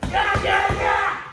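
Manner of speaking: high, loud full-throttle yeah; mock hyped yell. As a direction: shout it all-out like charging in. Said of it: "some folks are like".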